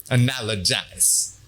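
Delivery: analogize spelled out slowly, each syllable clear; slow, careful enunciation. Shown as a demonstration. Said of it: "What was that word?".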